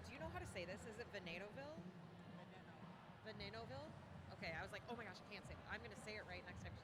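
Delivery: quiet off-air chat, away from the mic; off-mic aside. Real, not acted. Hushed aside to the co-host about a skater's name.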